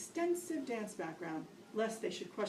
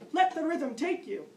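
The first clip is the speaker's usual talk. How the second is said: loud, higher coaching shout; yelled order; 7 semitones higher, 9 dB louder than usual. Retold or imitated. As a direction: call it over the music. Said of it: "instructor was yelling out".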